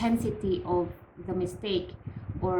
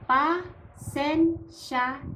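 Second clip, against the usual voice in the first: slow, higher "pa sen sha", each syllable apart; 5 semitones higher, much slower than usual. Shown as a demonstration. Teacher models the next phrase to repeat, syllable by syllable. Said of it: "repeat after me".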